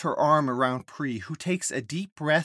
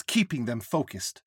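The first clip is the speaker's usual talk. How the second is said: ordinary delivery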